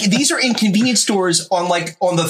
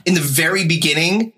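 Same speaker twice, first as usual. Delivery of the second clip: ordinary talk, nothing marked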